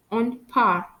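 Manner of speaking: French "part" with the final T silent. Shown as a demonstration. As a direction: end "part" on the R, dropping the T. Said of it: "I don't pronounce the letter T".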